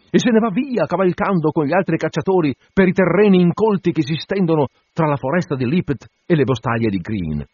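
plain reading voice

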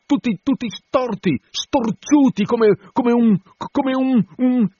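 very high, stammering harpooner's voice; excited, stuttering high-pitched cry; disjointed shouting. A narrator's reading. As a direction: blurt it in broken bursts, groping for the word. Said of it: "gridò in modo sconnesso".

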